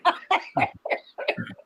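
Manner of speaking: breathless wheezing laugh, real; can't stop laughing at yourself; helpless laughter. Real, not acted. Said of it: "I'm dying".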